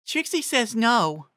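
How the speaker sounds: high, slow pony voice; Ditsy Doo's voice. A narrator's reading. Narrator voicing Ditsy Doo answering for Trixie, high and slow.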